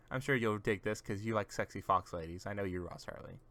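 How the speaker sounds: normal talking voice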